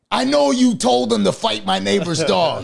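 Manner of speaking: high, loud, slow accusing yell; imitating angry friend. Friend furious on the phone, accusing him, loud and high.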